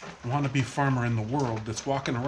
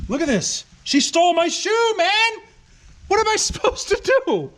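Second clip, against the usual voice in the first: high-pitched, outraged complaint; real high-pitched yelp of protest; exasperated outcry; 3 dB louder than usual. Real, not acted. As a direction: cry out in high, indignant disbelief. Dog has stolen his shoe.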